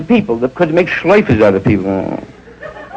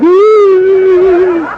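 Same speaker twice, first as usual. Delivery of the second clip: frightened gasp; 4 dB louder than usual. Retold or imitated. Passengers turning gray.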